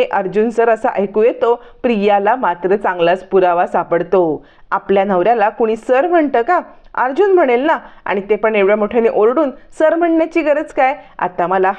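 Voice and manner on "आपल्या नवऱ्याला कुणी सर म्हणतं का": plain reading voice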